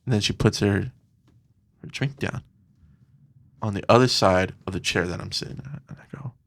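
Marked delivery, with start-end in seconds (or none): 1.8-5.8 hushed. Real, not acted. Hushed storytelling. 5.9-6.3 whispered thought. Retold or imitated.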